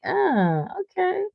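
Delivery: high, soft mock swoon; a hint of a charmed lady; impressed woman. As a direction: breathe it out like she's won over.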